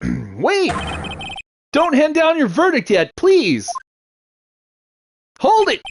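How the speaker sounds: high-pitched theatrical cry of objection; hammed-up high pleading shout; urgent courtroom interruption. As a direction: cut in desperately to stop the verdict. Lawyer interrupts the verdict.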